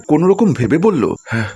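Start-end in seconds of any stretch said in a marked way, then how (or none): 1.2-1.5 weak, breathless hello; acted panting, barely able to speak. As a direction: gasp for air before answering the phone. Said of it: "তুমি হাঁপাচ্ছ কেন".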